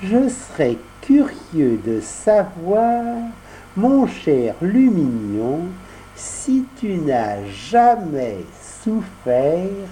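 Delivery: voix mielleuse. Acted. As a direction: sweet-talk him, slyly. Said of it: "d'une petite voix mielleuse et futée". (petite voix mielleuse et futée)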